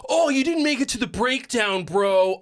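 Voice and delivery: high, mocking bro whine; mocking contest bro. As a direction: sneer it like a know-it-all contest kid. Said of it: "people are like".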